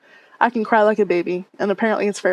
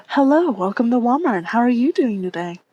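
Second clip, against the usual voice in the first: a touch higher, bright retail greeting; customer service voice; 3 semitones higher than usual. Retold or imitated. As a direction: greet the shopper like a cashier on shift. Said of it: "Does everyone have a customer service voice".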